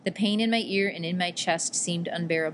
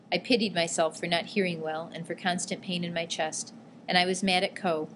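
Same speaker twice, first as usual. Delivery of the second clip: ordinary delivery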